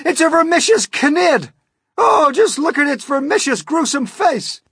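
very high, loud panic; horrified cry. A narrator's reading. Police chief pointing out Miss Spider's face, horrified cry. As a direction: shriek in horror at the sight. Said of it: "cried the chief of police".

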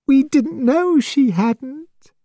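high, slow tearful mother. Acted. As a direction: voice wobbling with tears. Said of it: "said Missus Barnard in a tearful voice".

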